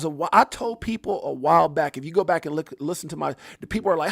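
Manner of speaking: ordinary talk, nothing marked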